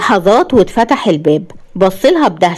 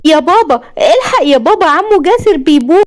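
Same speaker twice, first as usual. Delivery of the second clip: much higher, mocking child's tattling voice; mocking little sister; 8 semitones higher than usual. Husband mimics wife's little sister tattling to her father. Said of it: "وقلدها بسخرية".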